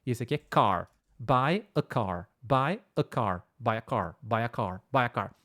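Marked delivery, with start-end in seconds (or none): 1.2-5.3 "Buy a car" lento e mais grave, cada palavra clara; repetição modelo. Shown as a demonstration. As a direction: repita "Buy a car" devagar, como professor. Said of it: "Você sabe falar isso corretamente?". (modelo lento de "Buy a car" em aula)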